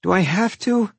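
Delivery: put-on high-pitched child's whine; pleading, whiny question; whined. A narrator's reading. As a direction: whine it reluctantly. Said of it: "he whined".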